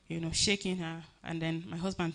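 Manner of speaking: ordinary talk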